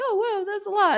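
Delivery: high, loud mock gasp of surprise; surprised reaction. Teammates amazed that six athletes are running Boston, high and loud. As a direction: toss it up high like friends amazed at the number. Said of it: "They're like".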